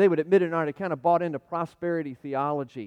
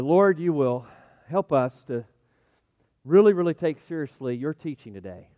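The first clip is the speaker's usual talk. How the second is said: fervent pulpit prayer; earnest prayer; 2 semitones lower than usual. Real, not acted. Pastor prays before the sermon, fervent. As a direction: pray it in full earnest.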